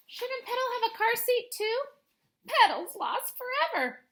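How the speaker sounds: high, small voice fretting over the tree; a child's anxious what-if; worried little girl. Acted. Wimberly fretting about her doll.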